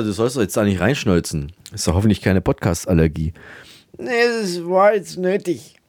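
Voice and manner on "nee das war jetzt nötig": Peter character voice. Acted. Peter voice answering.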